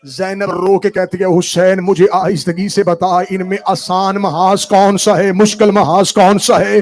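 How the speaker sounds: loud, slow grieving cry rising into sung shout; preaching at full force. Acted. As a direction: wail it out to the mourners. Builds into loud wailing recital. (بلند آواز میں گریہ آمیز بین)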